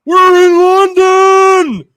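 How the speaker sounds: loud, slow, high announcer boom; Tony Khan impression. Host hyping the London crowd, loud slow announcer voice. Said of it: "and was like".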